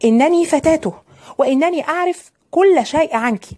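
put-on high-pitched, defiant young rival. A narrator's reading. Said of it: "وقالت في تحدي".

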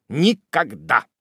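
kneeling Tupman refusing to rise, firm; chivalrous reply. A narrator's reading. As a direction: refuse gallantly. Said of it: "был рыцарский ответ".

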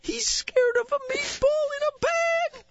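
high-pitched laughing wildlife narrator; nature documentary. Pretend. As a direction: gush like a nature host, cracking up.

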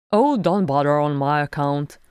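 loud, hearty protest. A narrator's reading. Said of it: "Bombur protested".

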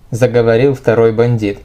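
plain reading voice

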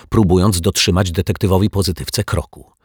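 ordinary delivery

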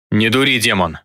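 mild growling command; understated angry growl; growled warning. A narrator's reading. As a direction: warn with a touch of anger. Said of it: "прорычал Виктор".

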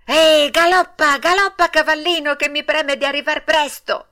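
high, loud gleeful riding cry; joyful, high-pitched yell; happy shout. A narrator's reading. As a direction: urge on a horse with childish delight. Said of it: "gridò tutto contento".

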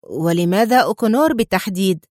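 plain reading voice

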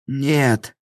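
high-pitched tearful cry of protest; tearful exclamation. A narrator's reading. Laam pleading with his attacker, tearfully. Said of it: "слёзно воскликнул Лаам".

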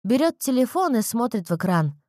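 ordinary delivery, nothing marked

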